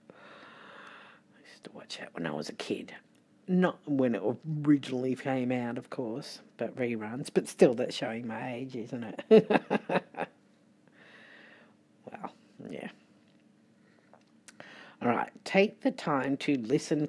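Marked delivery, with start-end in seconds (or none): 0.1-2.9 hushed confiding whisper. Real, not acted. Whispered aside about childhood show. 12.1-12.9 whispering. Real, not acted. Whispered after laughing at herself.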